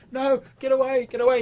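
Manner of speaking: loud, high-pitched scream to get away; screaming girl. Acted. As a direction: scream it like a frightened girl fleeing. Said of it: "starts, like, screaming, and she's like".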